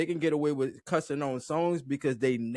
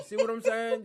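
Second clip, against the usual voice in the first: ordinary talk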